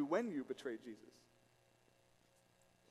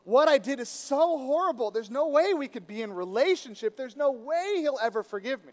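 anguished guilt; 5 semitones higher, 5 dB louder than usual. Acted. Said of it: "but you just feel like".

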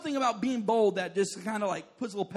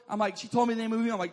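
ordinary talk, nothing marked

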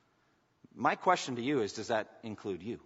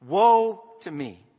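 lament; 7 semitones higher, 14 dB louder, much slower than usual. Retold or imitated. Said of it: "This is Isaiah's lamentation".

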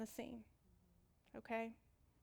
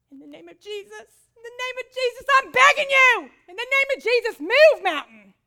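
much higher and louder, begging the mountain; desperate pleading shout; 10 semitones higher, 20 dB louder than usual. Retold or imitated. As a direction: build from command to begging yell. Desperate sister commanding mountain.

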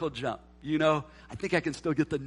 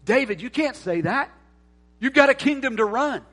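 loud, higher-pitched objection; mock protest; 8 semitones higher, 8 dB louder than usual. Preacher objecting to King David about his kingdom, mock protest. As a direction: scold him in raised, incredulous disbelief.

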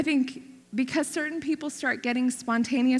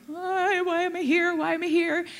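higher, puzzled repeated why am I here; bewildered asking; 7 semitones higher, 3 dB louder than usual. Retold or imitated. Feeling out of place among pastors, asks why, bewildered. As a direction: ask yourself in disbelief why you're there. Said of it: "I'm like".

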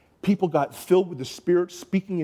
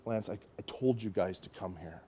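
voice of God; 6 semitones lower, 10 dB quieter than usual. Retold or imitated. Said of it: "the Holy Spirit said".

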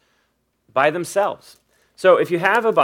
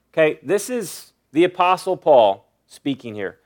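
ordinary delivery

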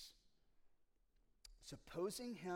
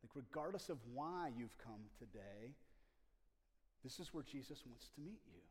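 ordinary talk, nothing marked

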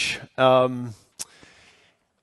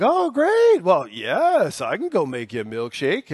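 put-on Southern accent. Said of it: "I'm talking in a Southern accent".

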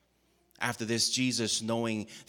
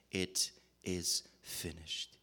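much softer, very slow and drawn out; quiet, slow last words; 2 semitones lower, 6 dB quieter, much slower than usual. Acted. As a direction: let the last words fade out slowly. Jesus dying on the cross.

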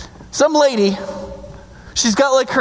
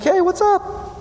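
breezy greeting; 7 semitones higher, much slower than usual. Acted. Jael greets the fleeing king.

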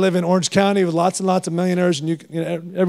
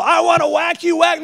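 high, mocking angry voice; preacher mocking the idea of an angry God; 10 semitones higher than usual. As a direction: caricature a God itching to punish. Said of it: "He's not mad at all".

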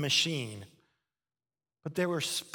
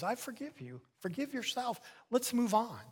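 gentle God voice; 7 semitones higher than usual. Acted. God voiced tenderly in sermon.

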